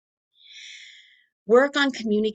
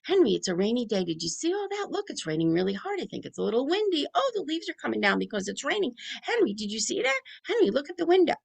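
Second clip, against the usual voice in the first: fast, much higher, barely a breath between phrases; nonstop rushed chatter; 7 semitones higher, faster than usual. Shown as a demonstration. Parent chattering nonstop at Henry about the rain, fast and high. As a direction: overwhelm the child with a torrent of words. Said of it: "Where if I was saying".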